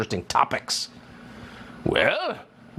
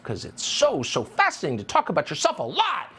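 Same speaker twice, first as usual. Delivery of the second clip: normal talking voice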